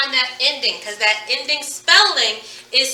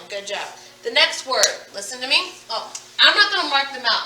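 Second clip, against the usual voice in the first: ordinary talk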